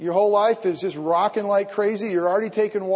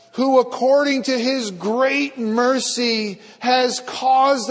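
slow, lifted reading voice; solemn scripture reading; 4 semitones higher, 4 dB louder than usual. Real, not acted. Pastor reads First Peter to the congregation with solemn weight. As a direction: read the verses out with weight.

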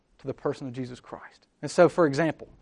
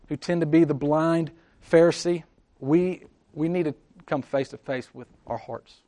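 usual speaking voice

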